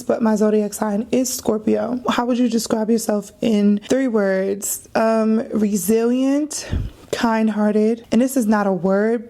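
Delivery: ordinary talk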